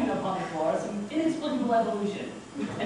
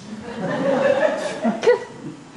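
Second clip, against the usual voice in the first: rising laugh; 11 semitones higher, 6 dB louder than usual. Real, not acted. Narrator laughs at his plight.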